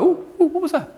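high, playful mock distraction; hammed-up scatterbrain; mock distracted. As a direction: squeal like a distracted kid with a laugh. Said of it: "but we're like".

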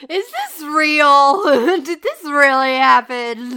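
loud, incredulous questions broken by laughter; laughing disbelief. Real, not acted. Listener reacting to an absurd news article, laughing in disbelief.

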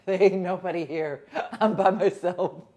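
loud, laughing insistence; laughing protest. Retold or imitated. Insisting to best friend the house is empty, laughing. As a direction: argue the point while cracking up. Laughing through her retold argument.

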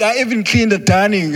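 put-on like a child showing off to mom; eager child. Eager child voiced in a story.

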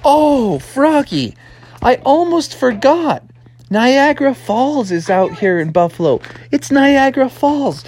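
high, awestruck father voice; amazed frog dad. Acted. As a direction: marvel to your son as it dawns on you. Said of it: "And Froggy's dad was like".

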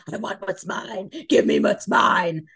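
slow, loud demanding shout. Retold or imitated. Quoting the ex in the book, slow loud demand. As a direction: bark it like an entitled ex. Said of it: "it just flew into".

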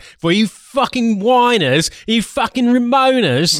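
high, rising indignant tirade; hammed-up aggrieved patriot; mock patriot rant. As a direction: build to a drawn-out, high-pitched scolding. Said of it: "Australian British patriot there".

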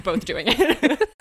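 slow, giggle-broken words; laughing helplessly. Real, not acted. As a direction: let the laughter break through.

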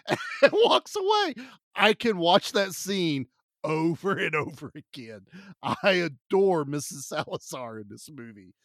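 ordinary delivery

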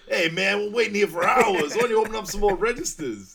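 put-on loud, high Boston accent, complaining at the register; Boston guy accent. Boston shopper yelling at the store, Boston accent. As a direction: play a Boston guy fed up in line. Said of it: "there's this very Boston guy's like".

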